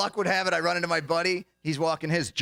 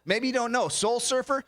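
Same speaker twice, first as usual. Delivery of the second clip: ordinary talk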